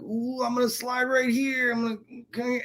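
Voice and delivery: mock the lazy guys sliding by; lazy slacker. Mocking the old Kenner guys coasting by, high and soft.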